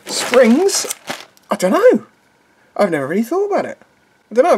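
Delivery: high puzzled questioning; baffled, voice rising up high. Real, not acted. Puzzling over strange object.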